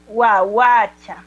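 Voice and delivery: guaguacha stretched very slowly; slow model word. Shown as a demonstration. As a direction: draw out guaguacha syllable by syllable. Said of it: "Nisa, ¿cómo sería?". (guaguacha, muy lento y claro)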